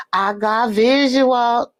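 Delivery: slow, stretched-out announcement; playful drawl. Pretend. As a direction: draw out "visual" playfully. Repeats her playful announcement.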